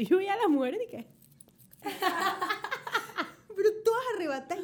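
laughing while telling the story. Real, not acted. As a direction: giggle out the memory. Laughing retelling of the scene.

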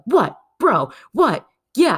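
punchy, high and loud bro challenge; mock macho bro. Guys in a street fight, mock aggressive bros. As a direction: square up like a guy picking a fight.

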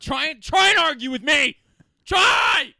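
high, loud dare; challenging shout. Acted. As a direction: yell it as a dare. Heated mock challenge to argue.

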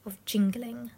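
slow, broken hush, fading to near-whisper; hushed storyteller building suspense. A narrator's reading.

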